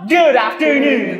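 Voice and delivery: slow, loud, hyped greeting; loud drawn-out greeting. Acted. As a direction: stretch it out big. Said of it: "parang hindi ka nakakasabay mas sa ano namin, energy".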